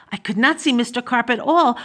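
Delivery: plain reading voice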